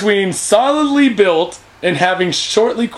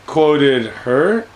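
confused; 6 semitones lower than usual. Real, not acted.

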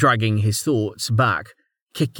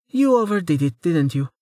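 female character voice; 7 semitones higher than usual. A narrator's reading. Amelia voiced by narrator.

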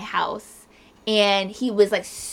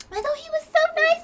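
very high imitation of an admirer; mock gushing. Mimicking friends fooled by the man, gushing.

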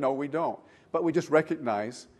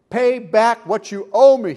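loud, higher-pitched demand; 6 semitones higher, 9 dB louder than usual. A narrator's reading. Servant confronts the man who owes him, loudly demanding. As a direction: come down hard on a debtor. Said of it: "he demanded".

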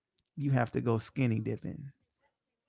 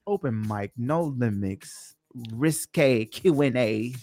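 slow announcer; slower than usual. Acted. Repeats show title, drawn out.

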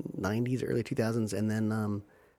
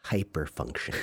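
ordinary delivery

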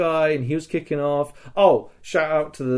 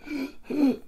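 gasp; 13 semitones higher, 4 dB quieter than usual. Pretend. Collapsing wounded on ground.

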